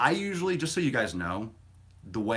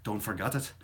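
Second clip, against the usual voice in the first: put-on Irish accent; 5 semitones higher, 5 dB louder than usual. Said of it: "I don't know why I said it with an Irish accent".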